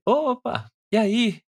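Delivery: shop owner voice. Retold or imitated. Snack bar owner asks a loyal customer about the cake, high and eager.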